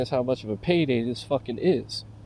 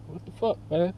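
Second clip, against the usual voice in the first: ordinary talk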